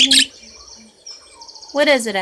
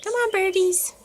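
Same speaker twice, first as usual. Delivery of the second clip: high, coaxing pet voice; 13 semitones higher than usual. Real, not acted. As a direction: sweet-talk the birds into singing. Coaxing birds to sing.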